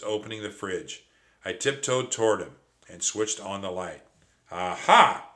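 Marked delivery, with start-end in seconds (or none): 4.5-5.3 loud triumphant aha; shouted aha. Acted. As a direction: catch the thief with a shout. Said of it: "I cried".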